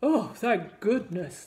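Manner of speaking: slow, loud storybook king; relieved king. A narrator's reading. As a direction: let relief out slowly, as a king. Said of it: "the king said, sniffing deeply".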